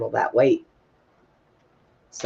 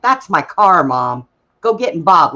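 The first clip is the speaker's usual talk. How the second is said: louder, higher push at mom; exasperated insisting; 4 semitones higher, 6 dB louder than usual. Retold or imitated. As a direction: hurry her out to the car. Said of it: "I'm like".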